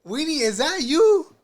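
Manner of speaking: loud, high, drawn-out taunt; high-pitched teasing holler; mock calling out. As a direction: call out slowly in a high teasing voice.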